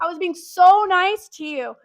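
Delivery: loud protest. Real, not acted. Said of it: "emphatic protest".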